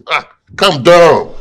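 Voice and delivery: insistent calm down. Acted. Father calming at the table.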